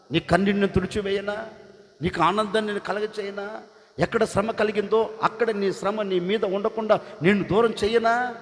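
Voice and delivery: high, loud, soaring promises; impassioned voice of God. Acted. God promising weeping Hagar comfort, high and impassioned.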